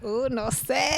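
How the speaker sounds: coy reply with a Spanish lilt; Spanish accent. Acted. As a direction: toss it out in a playful Spanish accent. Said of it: "That sounded Spanish".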